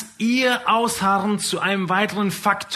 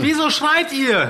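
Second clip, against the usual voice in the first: put-on child voice; 9 semitones higher, 4 dB louder than usual. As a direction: ask like a puzzled kid.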